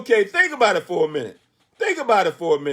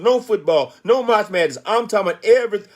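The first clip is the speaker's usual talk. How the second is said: ordinary talk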